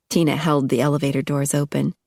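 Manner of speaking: usual speaking voice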